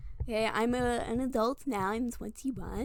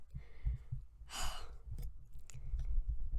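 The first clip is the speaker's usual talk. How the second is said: long, soft sigh; drawn-out quiet sigh; regretful sigh; 7 dB quieter than usual. Real, not acted. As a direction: let out a long breath of regret. Regret at own bad job.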